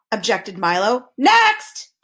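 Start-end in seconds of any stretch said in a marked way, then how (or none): 1.2-1.9 sudden high booming shout; bellow. A narrator's reading. Impatient king calling the next speaker, bellowed. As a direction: bark the single word out loud. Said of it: "bellowed the king".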